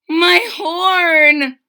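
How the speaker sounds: high loud dismayed cry; gulped cry. A narrator's reading. Trumpeter finds his horn blocked, loud dismayed cry. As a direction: gasp out the loss. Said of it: "he gulped".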